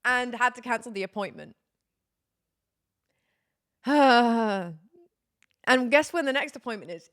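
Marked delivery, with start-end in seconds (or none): 3.8-4.8 long, loud exasperated exhale; heavy sigh. Real, not acted. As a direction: let out a big weary sigh. Reacting to cancelled appointment.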